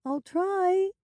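very high, plaintive little voice; small, reluctant, high-pitched moan.

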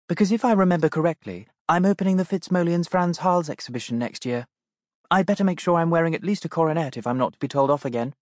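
a princess gently teasing; playful princess voice. Acted. Princess jokes with William.

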